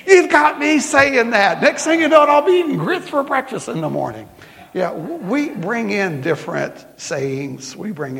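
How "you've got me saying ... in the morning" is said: much higher and louder, half laughing in mock alarm; shocked exclamation. Woman from Maine caught saying y'all, playful high shocked squeal. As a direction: squeal in playful horror at yourself. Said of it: "she went like this".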